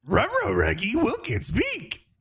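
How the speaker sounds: goofy dog talking through it; Scooby-Doo voice. Retold or imitated. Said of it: "impression from [name]".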